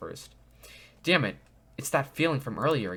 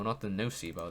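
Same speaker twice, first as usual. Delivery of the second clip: ordinary talk, nothing marked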